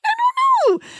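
very high, breaking cry; strained high cry. Real, not acted. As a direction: let the voice crack way up. Strained outburst on grim topic.

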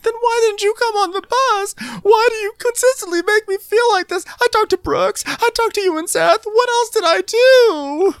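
mock cry-yelling in high falsetto; loud, squealing hammed-up wail; Jen. Podcaster mimics Jen pleading with Meredith, cry-yelling. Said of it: "she's cry yelling".

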